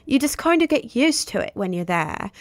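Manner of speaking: hammed-up English accent of a returning tourist; mock English accent. Mocking someone back from a week in England, put-on English accent. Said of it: "I'm not trying to be, like, a larp".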